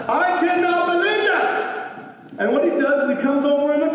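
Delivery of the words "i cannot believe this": higher, exaggerated mocking whine; mocking disbelief. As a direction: push the mockery up a notch. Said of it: "in a really mockery kind of way".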